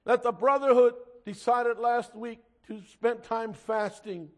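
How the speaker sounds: loud, impassioned pulpit preaching; fervent preaching. Acted. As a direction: preach it hard to the congregation. Preaching at full force.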